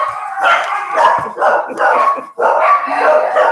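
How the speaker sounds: squeaky silent giggle; helpless high-pitched laughter at the dogs; high breathless laugh. Real, not acted. Laughing at the daughter's dogs barking at the door, breathless giggle.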